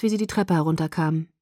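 plain reading voice

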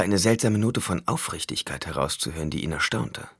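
usual speaking voice

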